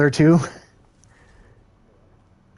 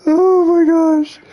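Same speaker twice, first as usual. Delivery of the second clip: high, slow, laughing to tears; real tearful laugh; helpless laughter; slower than usual. Real, not acted. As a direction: crack up until it almost turns to crying. Heard laugh and cry.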